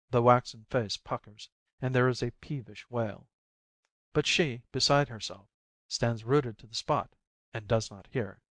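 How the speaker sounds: ordinary delivery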